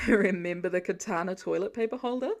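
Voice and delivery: giggly, through laughter; words bubbling with giggles; giggling. Acted. Laura giggling at shared memory.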